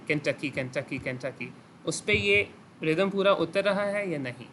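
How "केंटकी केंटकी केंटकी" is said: "Kentucky" looped steadily, sinking softer at the end; rhythmic repetition. Shown as a demonstration. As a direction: chant "Kentucky" over and over as an even three-syllable beat. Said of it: "syllables का जो rhythm है". ("Kentucky" को एक लय में लगातार दोहराएं)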